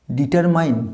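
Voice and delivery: ডিটারমাইন ending like "mine"; mispronounced ডিটারমাইন. Shown as a demonstration. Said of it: "ডিটারমাইন হবে না".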